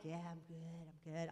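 low, quiet brush-off; retold subdued fib, dropped in pitch and volume; dismissive reassurance. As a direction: mumble it away, unconvincingly. Brushes off a friend's concern, retold.

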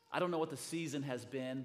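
ordinary talk, nothing marked

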